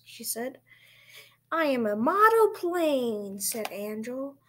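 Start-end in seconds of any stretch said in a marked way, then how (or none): 1.5-3.4 put-on louder, higher child at play; playful boast. A narrator's reading. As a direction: announce it loudly as a pretend plane. Child playing at being a plane.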